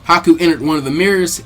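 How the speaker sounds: plain reading voice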